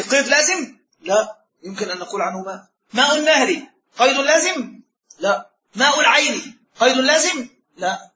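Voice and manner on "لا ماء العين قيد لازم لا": ordinary delivery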